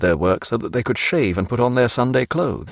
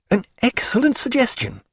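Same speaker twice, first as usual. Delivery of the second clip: lightly voiced doctor, higher; lightly voiced pleased doctor; doctor agreeing; 12 semitones higher than usual. A narrator's reading. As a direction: accept the idea brightly.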